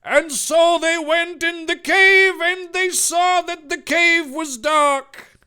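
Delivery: high, loud monotone chant like scripture; preacher. Acted. Reader intones the cave story like a preacher from the Bible, chanting loudly. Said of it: "just like a preacher reading from the Bible".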